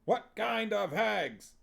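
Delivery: slow, high barked drill question; Huel's drill call. Acted. Huel drilling the witches, slow high barked question. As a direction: bark it like a drill sergeant.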